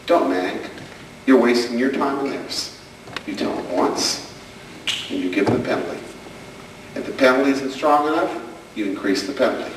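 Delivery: ordinary talk, nothing marked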